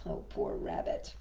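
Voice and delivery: soft whispered pity; whispering. Real, not acted. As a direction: breathe it out in sympathy. Whispered sympathy aside.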